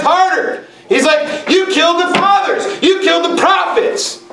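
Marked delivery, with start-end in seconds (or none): 1.5-4.2 Jesus denouncing them; mild mock scream. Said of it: "He's screaming at them".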